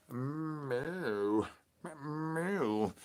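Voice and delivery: soft, quiet mewing cat; cat meow. Pretend. Cat answering the El Wushy call, soft meows. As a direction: mew back like a shy cat.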